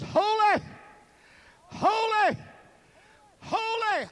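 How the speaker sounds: high, loud, drawn-out holy; exalted cry. Acted. Seraphim crying out before the throne, high and loud. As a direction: cry each holy out like an angel.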